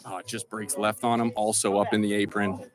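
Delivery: hushed golf commentary; golf commentator. Pretend. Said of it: "getting my Sports Center on here".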